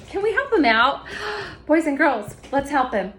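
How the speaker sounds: put-on high, bright rallying call to kids; kids show host. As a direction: cheer the kids on to help.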